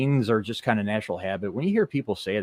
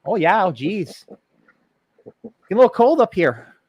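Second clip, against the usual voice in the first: Wisconsin accent; 9 semitones higher, 8 dB louder than usual. Shown as a demonstration.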